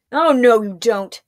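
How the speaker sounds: a gruff threat with a burst of volume; growled. Acted. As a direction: warn him off as you charge. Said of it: "growled Mavic".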